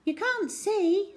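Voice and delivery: put-on high, slow girl's voice; girl correcting him from behind; know-it-all girl. A narrator's reading. Cherry speaks from behind.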